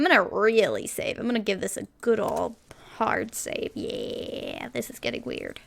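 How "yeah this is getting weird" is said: a creeped-out whispered aside; hushed uneasy aside. Real, not acted. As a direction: murmur it half to yourself. Uneasy aside while playing.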